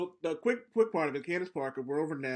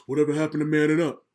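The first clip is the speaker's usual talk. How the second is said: louder, lightly voiced mock Shaq bluster; Shaq impression; 8 dB louder, faster than usual. Host mimicking Shaq on TV, loud mock bluster. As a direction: puff up like a blustering big man. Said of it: "That's a great Shaq impersonation".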